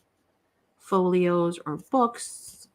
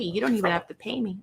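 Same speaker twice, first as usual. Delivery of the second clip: ordinary delivery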